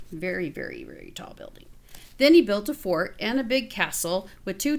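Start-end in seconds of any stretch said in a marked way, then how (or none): 0.1-1.6 awed whisper about the tall tower; fading to whisper. A narrator's reading. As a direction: voice drops to a breathy whisper. Hushed emphasis on tall.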